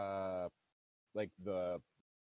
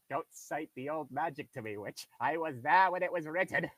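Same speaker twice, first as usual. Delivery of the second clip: mock Aslan voice; 4 semitones higher, 7 dB louder than usual. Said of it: "Aslan lines with the librarian".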